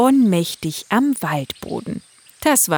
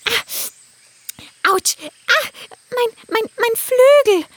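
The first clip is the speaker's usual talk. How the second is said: hurt wing, whimpering; pained cry; 4 dB louder, much slower than usual. Acted. Unicorn hurts her wing.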